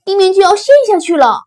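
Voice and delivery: loud, high, fast alarm; panicked shout. Acted. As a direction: blurt the warning at full volume. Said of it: "他们叫道". (惊慌急促地高喊)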